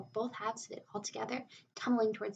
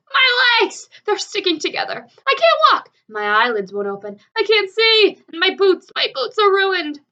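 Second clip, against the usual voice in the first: high, very loud furious wailing; angry scream; 10 semitones higher, 19 dB louder than usual. A narrator's reading. Centipede screams as the paint glues his legs. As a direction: scream at the ruin of it all. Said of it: "The centipede screamed with anger".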